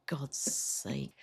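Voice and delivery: a mate muttering in embarrassment; whispered aside. Retold or imitated. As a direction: whisper it under your breath. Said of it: "his mate was just like".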